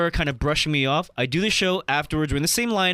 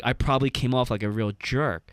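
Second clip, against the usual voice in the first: lower voice of a shy comic; 7 semitones lower than usual. Retold or imitated. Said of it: "he's like".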